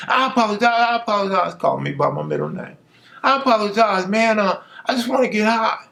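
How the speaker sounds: high, repeated begging apology; pleading apology. Retold or imitated. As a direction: beg forgiveness up high.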